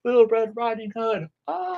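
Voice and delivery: high, put-on wolf voice; wolf calling lunch. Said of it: "So he's gonna be like".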